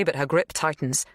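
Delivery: plain reading voice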